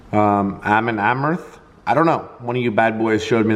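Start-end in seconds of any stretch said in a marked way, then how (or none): 0.6-1.6 "[name]" with short flat A, like AM-un AM-urth; trying a pronunciation. Shown as a demonstration.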